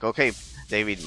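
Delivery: usual speaking voice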